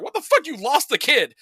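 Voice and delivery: high, loud disbelieving shriek of a wife; a wife outraged and stunned at once; mock-horrified yell at her husband; shocked wife. Wife hears kid is lost.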